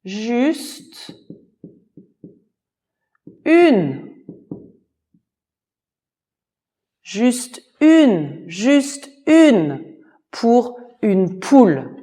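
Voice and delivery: high, loud shout; shouted plea. Acted. Master shouts after the fleeing guest, high and loud. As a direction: call after the runner. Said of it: "il criait".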